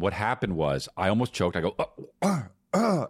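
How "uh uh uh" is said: high, strained choking grunts; choking noises. Retold or imitated. Brother re-enacts choking at the restaurant, choking sounds. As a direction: act out nearly choking. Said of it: "and I go like that".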